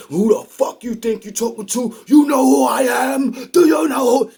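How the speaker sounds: high, loud mock tough-guy shout; a furious gangster demanding respect, shouted high; mad shout. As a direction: yell it like he's insulted. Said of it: "Did he get mad and say".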